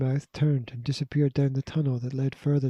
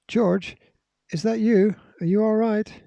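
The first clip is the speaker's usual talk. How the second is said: father calling out, much higher and louder; anxious calling; 7 semitones higher, 5 dB louder than usual. A narrator's reading. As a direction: call out worried from a distant cave. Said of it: "anxiously calling from somewhere beyond".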